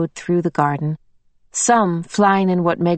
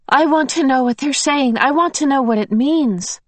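eager pleading; 7 semitones higher than usual. Acted.

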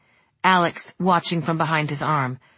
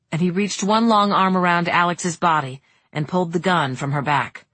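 usual speaking voice

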